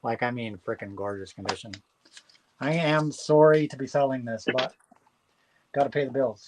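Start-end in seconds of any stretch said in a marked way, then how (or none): 0.0-1.7 much softer and lower, savouring it; hushed admiration. Real, not acted. Seller to bidder, praising the record's condition, hushed and admiring. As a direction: murmur it like a treasure. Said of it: "admiring the record".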